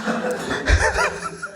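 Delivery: high unvoiced burst of laughter; real laugh at the joke; high breathy laugh. Real, not acted.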